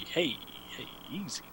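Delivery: soft hushing whisper; calm him down under your breath; hushed warning. Real, not acted. Hushing cohost about crude remark.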